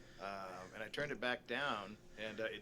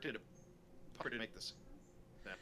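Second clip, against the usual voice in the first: usual speaking voice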